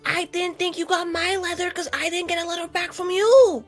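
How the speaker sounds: ordinary talk, nothing marked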